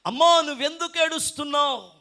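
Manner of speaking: high, loud, projected question; Jesus. Acted. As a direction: call it out with preacher's force. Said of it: "సాక్షాత్తు యేసు ప్రభువే".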